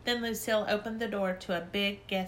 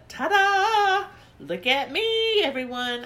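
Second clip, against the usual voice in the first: high, loud, slow grand entrance; showy ta-da; 11 semitones higher, 8 dB louder, slower than usual. Acted. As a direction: present yourself like a star. Lucille showing off nightie.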